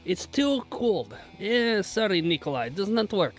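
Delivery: Russian accent. Shown as a demonstration. Streamer as a Russian complaining about the heat, Russian accent. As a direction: grumble apologetically in a thick Russian accent. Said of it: "I can do a Russian accent".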